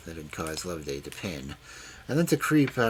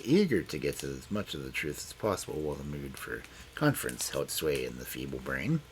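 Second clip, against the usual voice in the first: ordinary delivery, nothing marked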